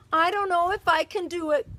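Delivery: hammed-up doubtful moan; a touch of a pirate's groan. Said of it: "begins to groan".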